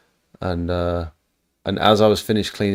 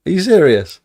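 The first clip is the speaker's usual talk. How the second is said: high, loud incredulous cry; astonished disbelief; 10 semitones higher, 8 dB louder than usual. Retold or imitated. Telling how he reacted when strangers recognized him, a loud amazed cry. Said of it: "I was like".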